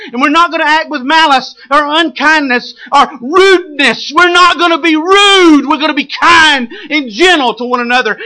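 impassioned sermon crescendo; full-force preaching. Real, not acted. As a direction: preach it high and insistent, punching key words.

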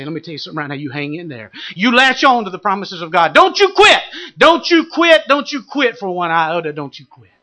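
preaching encouragement that builds to a shout; urgent exhortation. Acted. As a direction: urge them not to give up.

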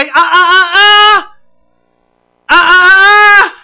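loud, high "ah, ah, ah" reprimand; last "ah" held high and long; scolding warning. Acted. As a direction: stop a child short, loudly. Parent checking a child's talk.

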